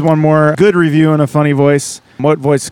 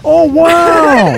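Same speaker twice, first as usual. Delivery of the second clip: high drawn-out wondering wow; Owen Wilson wow; 11 semitones higher, 4 dB louder than usual. Pretend. Host answers his friend's wow as a high Owen Wilson. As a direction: draw out the wow like the actor. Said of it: "Owen Wilson".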